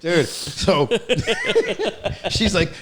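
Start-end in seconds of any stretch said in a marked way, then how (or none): 0.9-2.2 high-pitched burst of laughter; real squealing laugh; cracking up, very high. Real, not acted. Friends laughing at impression.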